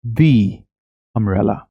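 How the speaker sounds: "the" said as "thee" before "umbrella"; teacher's model "the umbrella", "the" as thee; correct "the" before a vowel. Shown as a demonstration. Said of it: "तो हम इसको बोलेंगे".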